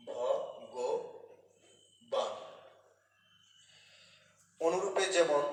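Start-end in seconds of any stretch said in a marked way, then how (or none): 0.1-2.3 ভগবান stretched very slowly and softly; teacher slowly draws out ভগবান for the class; drawn-out model word. Shown as a demonstration. Grammar teacher drawing out ভগবান for students, very slow. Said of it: "এর উচ্চারণ হয় ভগবান রূপে".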